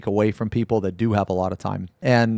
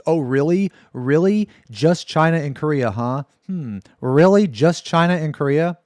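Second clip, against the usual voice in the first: sarcastic disbelief; 5 semitones higher, 4 dB louder than usual. Real, not acted. Sarcastic reaction to the quote.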